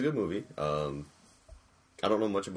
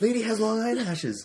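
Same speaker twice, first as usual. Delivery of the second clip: very high, loud, drawn-out exclamation; hammed-up amazed outburst; excited discovery; 7 dB louder, slower than usual. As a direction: squeal it like an astonishing revelation. Imagined excited reaction to film.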